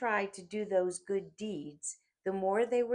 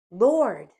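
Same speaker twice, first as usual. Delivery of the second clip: much louder anguished call; desperate cry; 5 semitones higher, 13 dB louder than usual. A narrator's reading. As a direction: cry out to him with last strength. Dying thief calls out.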